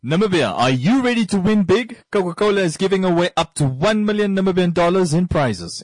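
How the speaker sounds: energetic promotional radio read; live ad read. Real, not acted. Radio host announcing a prize giveaway to Namibia, promotional. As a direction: sell the big prize to the whole country.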